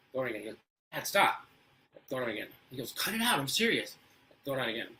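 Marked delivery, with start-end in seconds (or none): 0.9-1.4 put-on a louder, fed-up kid's plea; annoyed kid. Son annoyed by thrown notes. 2.9-4.0 higher and louder, clearly fed up; exasperated kid. Retold or imitated. Son increasingly annoyed.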